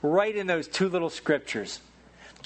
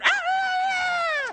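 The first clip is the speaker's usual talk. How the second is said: high screechy rooster crow; imitated rooster crow. Preacher mimics the rooster crowing at the full moon. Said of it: "when you had a full moon".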